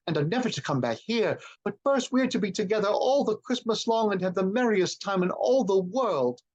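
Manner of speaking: put-on high child's voice, slowing at the end; an excited little girl drawing it out; little Fan's voice. A narrator's reading. Little Fan continues.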